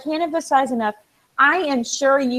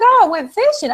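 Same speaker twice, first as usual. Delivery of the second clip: quoted friend; 8 semitones higher, 5 dB louder than usual. Retold or imitated. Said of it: "she's like".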